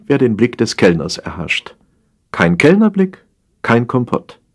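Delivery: plain reading voice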